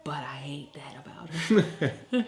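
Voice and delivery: slow whisper breaking into a laugh. Real, not acted. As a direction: whisper the confession and giggle. Confessing dislike, laughing.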